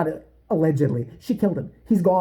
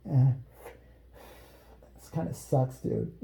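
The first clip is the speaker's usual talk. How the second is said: deadpan; 8 semitones lower, 8 dB quieter than usual. Retold or imitated. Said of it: "to go".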